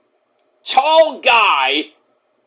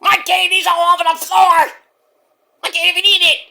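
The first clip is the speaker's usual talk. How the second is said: high, whining commentator voice; much higher mock whimper of a commentator; whiny Vic Joseph; 11 semitones higher than usual. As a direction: whine about the lost candy. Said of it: "Vic Joseph's like".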